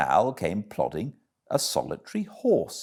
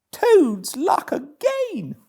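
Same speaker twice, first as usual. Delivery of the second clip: high, loud, cocky little Toad voice; a conceited toad cheering himself on, pitched way up; Toad talking to himself; 6 dB louder, slower than usual. Acted.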